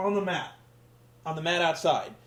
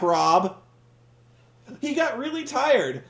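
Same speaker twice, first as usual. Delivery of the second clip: normal talking voice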